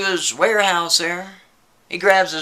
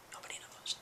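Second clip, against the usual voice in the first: a barely audible whisper; fading whisper; 26 dB quieter, faster than usual. Acted. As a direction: let the whisper die away, even fainter. Spooky aside, repeated fainter.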